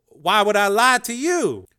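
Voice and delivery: defensive, caught off guard; defensive Dev. A narrator's reading. Dev denying the lie, taken aback.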